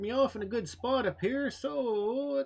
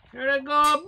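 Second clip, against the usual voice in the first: ordinary delivery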